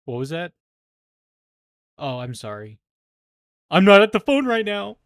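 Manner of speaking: builds to a loud high "I'm not at the phone right now"; voicemail prank imitation. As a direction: act out the fake pickup, then blast the punchline. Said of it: "Is that".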